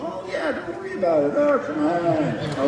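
high, airy dismissive voice; mock the careless crew; mocking brush-off. Crew mocked as brushing him off.

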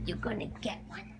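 fast teasing whisper; soft secret promise. Acted. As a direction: hush it quickly to the dog. Teasing the dog with cookie.

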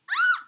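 loud, very high shriek; startled scream. Real, not acted. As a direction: shriek as the monster appears. Startled during scary game.

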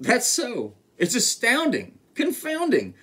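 high, delighted astonishment; amazed elephant. A narrator's reading. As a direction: marvel as the storybook elephant. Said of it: "laughed the elephant".

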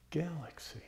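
put-on whispered country-accented word; barely voiced, after a long pause; hushed naming. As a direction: let the name out in a soft whisper. Hushed naming moment in recited story.

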